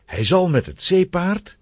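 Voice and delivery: normal talking voice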